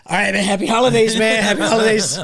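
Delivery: high, loud laughing pleas while being squeezed; strained laughing. Retold or imitated. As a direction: laugh through it, gasping to be let go.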